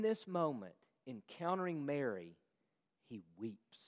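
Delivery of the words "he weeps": hushed. Real, not acted. Jesus grieving with Mary.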